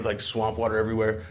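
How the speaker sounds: usual speaking voice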